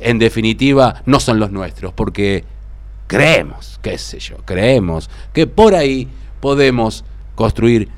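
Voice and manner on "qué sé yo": ordinary delivery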